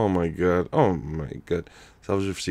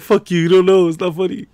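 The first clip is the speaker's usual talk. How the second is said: mock friend; 10 semitones higher, 8 dB louder than usual. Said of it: "He's like".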